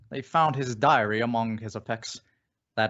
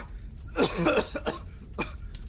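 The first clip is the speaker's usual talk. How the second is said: rough smoky coughing fit; coughing in the burning hall; choking cough; 14 semitones higher, 5 dB quieter than usual. Acted. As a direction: choke on the smoke. Choking smoke.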